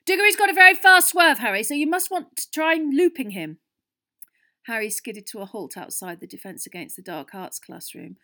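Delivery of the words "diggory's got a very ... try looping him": very loud, high distant call; shouting after him. A narrator's reading. Captain calling tips after Harry as he runs, shouting. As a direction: yell advice down a corridor. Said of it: "with Wood shouting after him".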